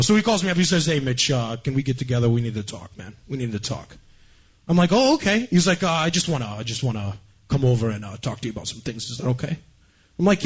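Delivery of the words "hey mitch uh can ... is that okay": Stoli's low New Jersey mumble; New Jersey bro. Retold or imitated. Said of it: "talks like this".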